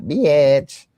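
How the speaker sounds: loud, elongated mock insult; drawn-out mock insult. Host teasing about the clip's insult, drawn-out mock jab. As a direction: stretch the word out, jumping up high. Imitating how the insult was said.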